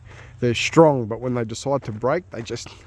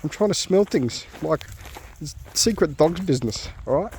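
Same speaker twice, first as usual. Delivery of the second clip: voicing the dog; 7 semitones higher than usual. Owner voicing his dog as a huffy sniffer. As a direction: play the dog who wants to be left alone. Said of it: "He's like".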